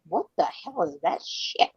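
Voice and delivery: disgusted reaction. Retold or imitated. Mother reacting to jarred sauce on pasta, slow and disgusted. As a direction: drag out the disgust under the breath. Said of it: "I'm like".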